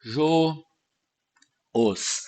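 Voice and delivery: each piece said on its own, slowly; syllable by syllable. Shown as a demonstration. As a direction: split into syllables with long pauses between. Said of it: "você separa assim".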